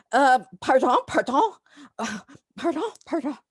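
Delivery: high, clipped repeated pardon, mock French matron; offended old lady. Old lady snubbing a tourist, mock huffy French pardons. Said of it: "the older lady goes".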